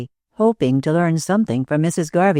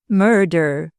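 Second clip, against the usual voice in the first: ordinary delivery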